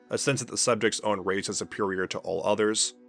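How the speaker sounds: ordinary delivery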